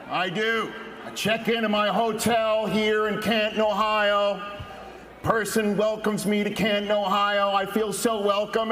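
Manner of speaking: ordinary talk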